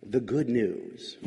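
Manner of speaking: "The good news" said low, soft and slow, without any emphasis; flat, quiet take. Shown as a demonstration. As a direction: let "The good news" drop away quietly and plainly. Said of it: "depends on how emphatically you say it".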